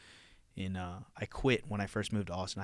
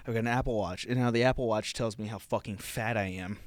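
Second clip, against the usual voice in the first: ordinary talk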